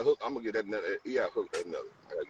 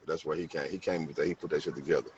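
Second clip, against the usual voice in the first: ordinary talk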